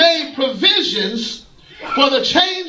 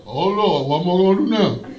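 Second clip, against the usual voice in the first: a lower, fast mocking echo of the whine; mock the whiner back, a touch lower; God mimicking; 5 semitones lower than usual. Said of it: "God mimics me".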